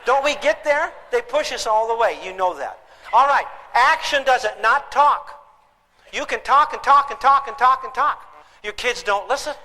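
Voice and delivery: normal talking voice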